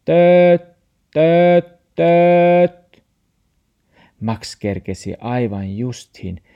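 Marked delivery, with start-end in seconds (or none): 0.0-3.0 loud, high held honks; imitated truck horn. A narrator's reading. Truck bears down on Max, blaring horn imitation. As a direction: honk like a truck horn in three blasts.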